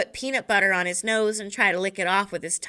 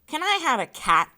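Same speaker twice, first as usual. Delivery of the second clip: put-on 1 pestering boy; 8 semitones higher than usual. Boy pestering his mother.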